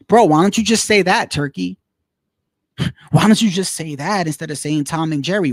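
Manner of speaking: exasperated outburst. Retold or imitated. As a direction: half-shout it with a laugh, like "come on, man". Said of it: "I'm like".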